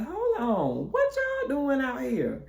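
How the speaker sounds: high, slow, stretched-out question; surprised challenge. Retold or imitated. Shrek walking up on unexpected visitors, taken aback. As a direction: question them in disbelief. Man surprised by their arrival.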